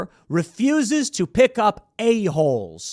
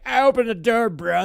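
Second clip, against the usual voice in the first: put-on drunk bro; 6 semitones higher, 4 dB louder than usual. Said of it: "was like".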